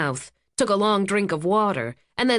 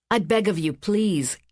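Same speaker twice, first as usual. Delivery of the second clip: plain reading voice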